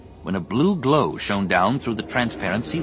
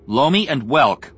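slow, louder, hard anger; angry, deliberate naming; 6 semitones higher, 5 dB louder than usual. A narrator's reading. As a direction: lay the names down hard, slowly. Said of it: "his voice hard and angry".

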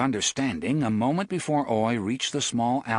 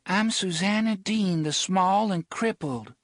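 higher, proud and calm; lightly voiced Susanna; 8 semitones higher than usual. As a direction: speak with polite defiance.

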